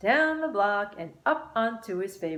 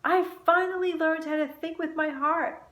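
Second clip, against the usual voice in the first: excited; 10 semitones higher, 4 dB louder than usual. A narrator's reading. Child's joyful realization.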